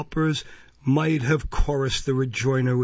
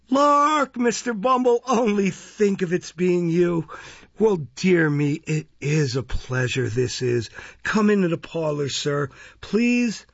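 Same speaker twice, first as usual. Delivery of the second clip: high, gushing welcome; delighted gushing; 5 semitones higher than usual. Acted. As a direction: gush with fawning delight. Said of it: "exclamations of delight".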